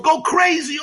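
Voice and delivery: high, agitated rise; mock panic. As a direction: work up toward a frantic wail. Mimicking panicked people.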